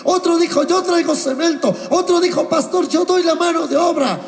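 preacher building to a joyful peak; excited preaching. Retold or imitated. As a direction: pile up each pledge with joy. Retells eager pledges rising.

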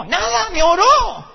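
deflated comic complaint to his wife; mock exasperation. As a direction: drag it out in comic disgust. Answering wife, disappointed.

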